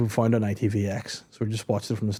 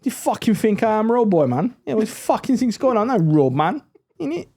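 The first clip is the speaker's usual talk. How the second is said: high, heated mock London street accent; exaggerated Top Boy accent; 10 semitones higher, 3 dB louder than usual. Told to take the bin out, mock-indignant street accent. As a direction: protest in hammed-up street accent. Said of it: "in that accent".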